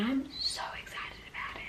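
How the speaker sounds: soft breathy whisper. Real, not acted. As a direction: whisper so nobody wakes. Early morning vlog, keeping quiet.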